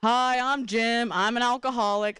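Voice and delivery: slow, lightly voiced meeting introduction; adult at AA meeting. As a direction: introduce yourself slowly like an AA regular. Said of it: "every adult I knew was like".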